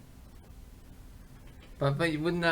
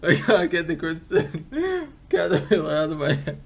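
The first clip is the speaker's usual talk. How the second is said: giggling through words; laughing helplessly while talking; 4 semitones higher than usual. Real, not acted. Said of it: "What's so funny?".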